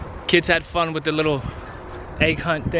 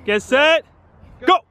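race start call; 8 semitones higher than usual. Real, not acted. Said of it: "Get ready, guys".

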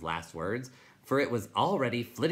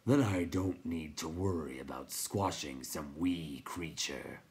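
crackling rock voice; 3 semitones lower than usual. A narrator's reading.

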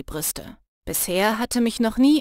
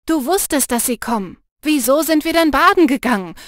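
laut und scharf vorwurfsvoll; angefaucht; 4 semitones higher, 6 dB louder than usual. A narrator's reading. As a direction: faucht die Freundin laut an. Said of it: "fauchte ich Rajani an". (wütendes lautes Anfauchen)

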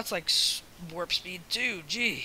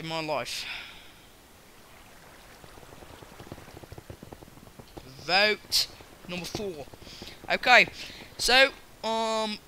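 louder, higher mock British accent; put-on British accent; 4 semitones higher, 5 dB louder than usual. Gamer talking to viewers between rounds, mock British accent. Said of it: "sorry about the accent".

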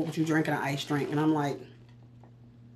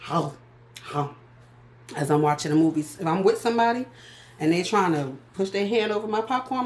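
ordinary talk, nothing marked

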